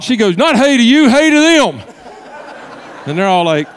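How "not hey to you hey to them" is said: high, loud scolding retort; mock-exasperated holler setting him straight; loud correction. Woman correcting pastor before visitors, loudly scolding.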